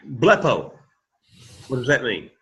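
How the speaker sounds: normal talking voice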